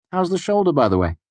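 lightly voiced Bast. A narrator's reading. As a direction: pitch it up, easy and friendly. Bast's voice.

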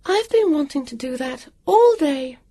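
put-on high girlish voice with a crying edge; a high young girl, half crying; high tearful girl; girl's satisfied voice. A narrator's reading. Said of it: "she said".

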